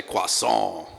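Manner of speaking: "croissant" with a French nasal vowel; French-style "croissant". Shown as a demonstration. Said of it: "like you're French".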